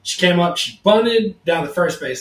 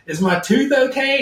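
worried question; 8 semitones higher than usual. Retold or imitated. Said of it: "he goes".

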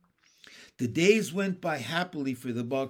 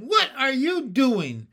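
high, loud, slow outraged question; shocked cry; 11 semitones higher, 10 dB louder, slower than usual. A narrator's reading. Jessie catches Benny cutting Watch's hair, shocked cry. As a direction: shout it out, stretching the words. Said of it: "she cried".

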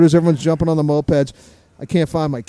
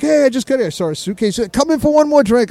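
friend's eager invitation; 6 semitones higher than usual. Retold or imitated.

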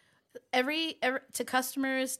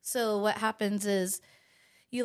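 normal talking voice

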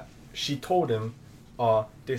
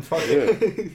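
laughing; 6 semitones higher, 6 dB louder than usual. Real, not acted. Laughing at friend's story.

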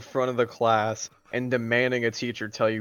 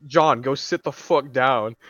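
slow, loud mock scolding; stern teacher; 2 semitones higher, 5 dB louder, slower than usual. Retold teacher sending John back to his seat, stern. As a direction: imitate a fed-up teacher giving an order.

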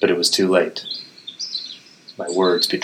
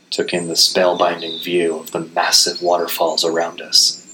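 ordinary delivery, nothing marked

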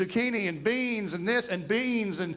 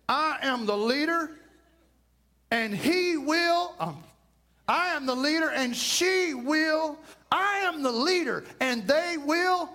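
higher, puffed-up boasting; mock proud claim; 5 semitones higher than usual. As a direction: parody a bossy husband. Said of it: "raise their hand and say".

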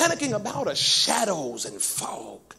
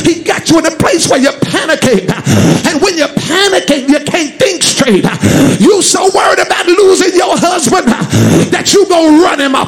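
loud, relentless sermon shout, climbing higher toward the end; full-force preaching; 6 semitones higher, 13 dB louder than usual. Real, not acted. Preacher warns the congregation what panic does to them, full-force preaching. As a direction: drive each phrase home like a fired-up preacher.

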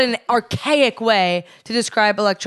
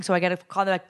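ordinary talk, nothing marked

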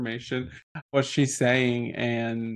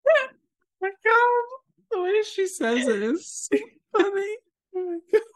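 very high, squeaky laughing voice; helpless real laughter squeezing the words high; crying with laughter. Real, not acted. Host cracking up at a favorite scene, crying with laughter. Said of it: "I'm crying".

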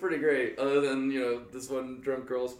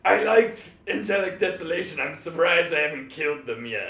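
loud, raised-pitch character voice; Maris the Great; 3 semitones higher, 4 dB louder than usual. Retold or imitated. Character grudgingly compliments the band to its members, loud hammy impression. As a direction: open big and theatrical, then ease off. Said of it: "walked up and he goes".

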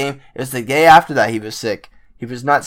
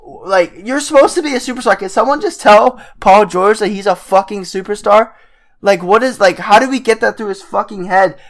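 high-pitched, worked-up frustration; exasperated rant; 8 semitones higher, 4 dB louder than usual. Real, not acted. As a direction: plead in exasperation, pitched well up. Ranting that player ignores his status.

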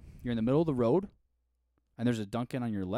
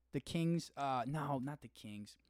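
ordinary talk

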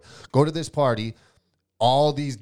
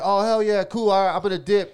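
embarrassed; 7 semitones higher, 5 dB louder than usual. Retold or imitated. Embarrassed kid backing off.

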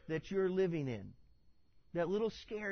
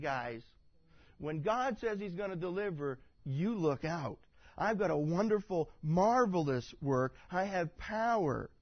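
ordinary talk

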